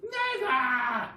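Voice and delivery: loud high protest; crying Mrs Twit. Acted. Wife crying out in loud denial. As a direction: cry out in denial. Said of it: "she cried".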